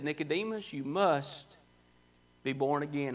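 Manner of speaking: soft, low, intimate command; preacher voices Jesus gently telling Nicodemus; quiet and earnest. Retold or imitated. Quiet earnest command.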